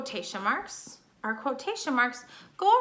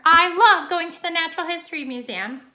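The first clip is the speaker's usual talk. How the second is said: excited; 4 semitones higher, 7 dB louder than usual. Shown as a demonstration. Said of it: "She's excited".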